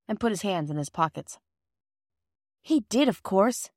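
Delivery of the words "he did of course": plain reading voice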